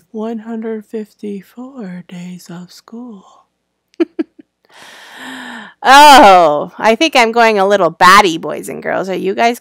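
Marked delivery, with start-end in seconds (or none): 0.1-3.5 slow whispery robot monotone. Shown as a demonstration. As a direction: drone it out like a robot. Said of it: "Now I sound like a robot".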